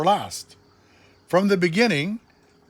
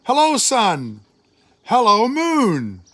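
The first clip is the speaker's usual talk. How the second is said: higher, louder welcome, slowing; joyful greeting; 6 semitones higher, 5 dB louder than usual. A narrator's reading. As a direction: greet sun and moon warmly. God greets creation joyfully.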